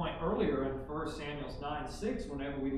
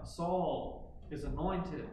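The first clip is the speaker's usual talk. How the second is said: proclaiming; 2 semitones higher, 4 dB louder than usual. Acted. Preacher declaring Saul God's chosen commander, building loud. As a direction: build each phrase up in rising proclamation.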